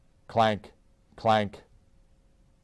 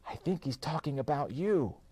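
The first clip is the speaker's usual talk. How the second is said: soft, higher worried whisper; 7 semitones higher, 5 dB quieter than usual. A narrator's reading. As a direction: whisper it nervously. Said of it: "Danny whispered".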